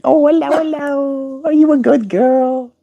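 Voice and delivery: squeaky high pet talk; high baby talk. Retold or imitated. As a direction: coo up high at the bird. Retelling her cooing at bird.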